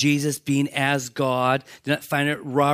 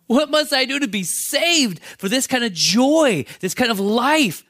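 desperate plea; 8 semitones higher, 5 dB louder than usual. Retold or imitated. Jailer begging Paul to be saved.

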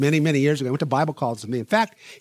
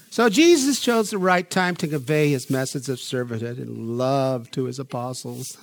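usual speaking voice

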